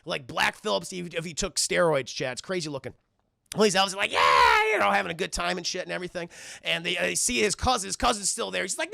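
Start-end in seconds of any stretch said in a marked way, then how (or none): 4.1-4.7 loud, rough whoop; imitating a gleeful rabble; mock elf cheer. Elves cheering and having fun.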